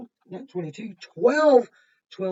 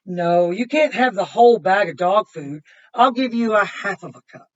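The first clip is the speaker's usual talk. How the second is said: bright, louder storybook read-aloud; reading to children; 2 semitones higher, 10 dB louder than usual. Acted. Reading a picture book to kids, bright and lively. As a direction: read it out lively for the little ones.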